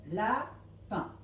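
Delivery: LAPIN split as la... pin; syllable by syllable. Shown as a demonstration. Teacher confirming the second box, LAPIN split into syllables. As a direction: say LAPIN in two separate beats. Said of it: "autant de cases qu'il y a de syllabes".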